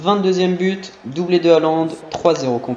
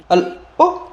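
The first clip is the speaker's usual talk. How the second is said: surprised; 12 semitones higher than usual. Real, not acted. Corner nearly goes in.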